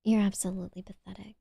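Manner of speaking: cold, soft whisper of contempt; whispered degradation. Shown as a demonstration. Demoing degradation to chat in a contemptuous whisper. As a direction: hiss the insult in a whisper, fast at the end. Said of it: "you just have to be like".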